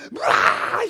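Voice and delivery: loud breathy scream of fright; startled shriek. Retold or imitated. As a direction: shriek like something jumped out at you. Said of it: "and she goes".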